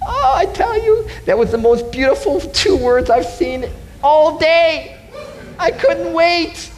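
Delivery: high, wailing joy and relief; overjoyed, voice soaring way up; exuberant. Retold or imitated. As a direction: gush as if the end is finally in sight. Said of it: "it was exuberant".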